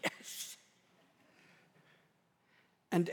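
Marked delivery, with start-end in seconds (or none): 0.0-0.6 breathy whispered eager yes. Retold or imitated. Pastor answering his wife's dinner text in a whisper. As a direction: whisper it like a relieved husband.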